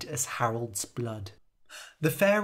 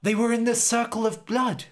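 high, loud realization; shouted; 7 semitones higher, 3 dB louder than usual. Acted. Fairy King shouts that they hid in the blood circle, high shout. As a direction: shout it to the others. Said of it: "shouted Jasper's father".

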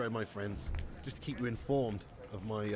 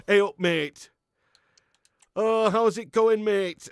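loud, drawn-out mock Manchester greeting; proper Manc accent; 6 semitones higher, 9 dB louder, much slower than usual. Perry back home greeting his mate, proper Manc accent. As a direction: ham up the Manc accent, higher and louder. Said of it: "He comes back with a proper Manc accent".

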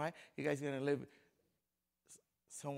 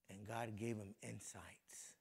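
softer, slow whispered line; 6 semitones lower, 10 dB quieter, slower than usual. Acted. Preacher to congregation, a soft slow whisper. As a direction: hush it like a secret.

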